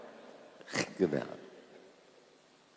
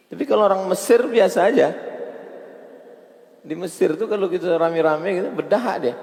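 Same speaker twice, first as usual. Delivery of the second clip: animated telling; 7 semitones higher, faster than usual. Acted. Describing Egyptians, animated.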